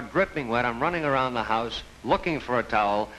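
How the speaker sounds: normal talking voice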